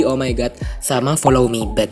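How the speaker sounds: usual speaking voice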